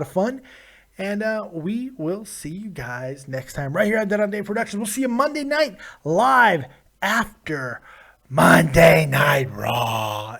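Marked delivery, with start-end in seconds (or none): 4.8-10.4 announcer voice. Acted. Announcer hype for show.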